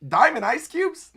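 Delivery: acted incredulous outburst, high and loud; freaking out. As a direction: play a character freaking out in disbelief. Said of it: "Nami freaking out going".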